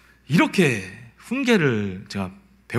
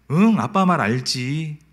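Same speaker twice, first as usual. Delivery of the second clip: slow coaxing; slower than usual. Retold or imitated. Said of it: "제가 그래요".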